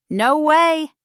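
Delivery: high, loud burst of disbelief; surprised. Shown as a demonstration. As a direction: let the voice jump up in shock. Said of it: "let's explore surprise".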